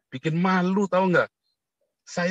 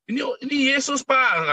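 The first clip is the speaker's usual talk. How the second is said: higher-pitched mock Chinese official; a lightly voiced Chinese man pointing it out; mild imitation, raised pitch; voicing a Chinese man; 10 semitones higher than usual. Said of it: "katanya si orang Cina ya".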